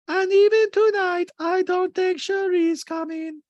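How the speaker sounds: very high, loud, slow mocking voice; Diana. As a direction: mimic a guest in exaggerated falsetto. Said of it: "And Diana goes".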